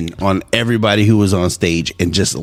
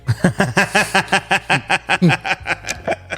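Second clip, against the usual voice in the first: high, rapid run of cackling laughs; long, high burst of giggling; real laughter; 8 semitones higher, 5 dB quieter than usual. Real, not acted. Podcast host after retelling a roast joke, real laughter.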